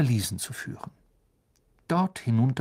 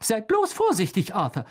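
high, sharp warning; cutting voice; 10 semitones higher than usual. A narrator's reading. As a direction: cut in sharply to warn a husband. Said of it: "mit schneidender Stimme".

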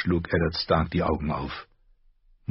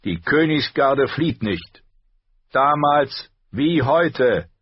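slow, higher solemn vow; solemn knight; 8 semitones higher, 5 dB louder, slower than usual. A narrator's reading. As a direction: declare the Kingsguard never flees. (feierlicher Ritter, langsam)

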